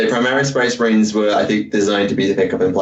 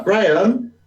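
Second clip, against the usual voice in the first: put-on confused young reader; 9 semitones higher, slower than usual. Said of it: "just like".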